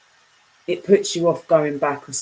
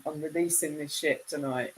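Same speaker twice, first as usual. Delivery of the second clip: usual speaking voice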